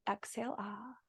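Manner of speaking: exhale, then a faint breathy "ah"; soft breathy ah. Shown as a demonstration. Yoga teacher guiding the exhale, faint breathy ah. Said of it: "take two of these here".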